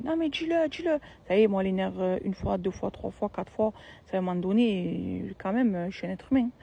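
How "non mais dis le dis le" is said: high, insistent repeat; nagging taunt. Retold or imitated. Sister-in-law pestering again, high nagging taunt. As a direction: push her to say it, higher. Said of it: "elle me dit".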